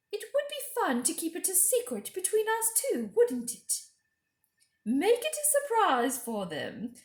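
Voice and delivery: high, sweet coaxing queen; high, coaxing witch-queen voice; queen's voice. Acted. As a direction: lure the boy in with a high honeyed voice. Queen speaks.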